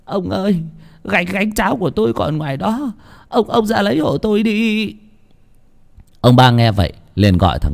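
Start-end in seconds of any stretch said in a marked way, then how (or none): 0.0-4.9 high, stammering plea; whimpering old woman, high and halting; choked up. Acted. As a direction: beg tearfully in a small high voice. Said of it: "gật đầu nghẹn ngào".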